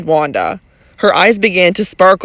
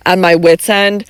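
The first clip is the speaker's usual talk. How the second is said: usual speaking voice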